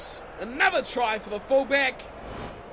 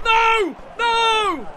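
loud, higher cries of no; despairing shout; 8 semitones higher, 7 dB louder than usual. Real, not acted. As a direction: yell it at the screen as the ball is lost. Team loses the ball.

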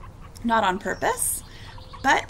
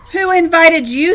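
higher, louder storybook indignation; grumpy challenge; 6 semitones higher, 10 dB louder than usual. A narrator's reading. Hank confronting the lizard, grumpy challenge. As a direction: challenge an unwelcome visitor.

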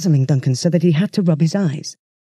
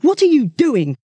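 angry demand; 9 semitones higher, 3 dB louder than usual. Acted. Duncan rounding on Dave.

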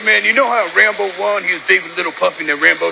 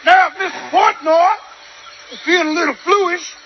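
drill sergeant shout; 14 semitones higher than usual. Acted. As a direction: bark the orders across the squad. Sergeant barking orders at troops.

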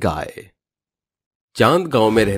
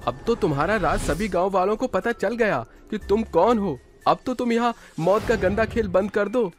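put-on much higher, softer child voice; a child urging the witch to stop; child telling the witch to stop killing; young friend; 12 semitones higher, 4 dB quieter than usual.

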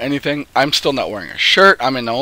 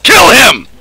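loud high shout of urgency; 11 semitones higher, 10 dB louder than usual. Real, not acted. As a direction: yell it at the screen, all in. Yelling during fight.

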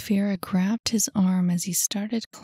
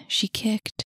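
plain reading voice

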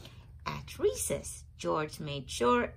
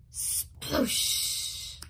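sploosh sound. A narrator's reading. Bucket spills, sound word.

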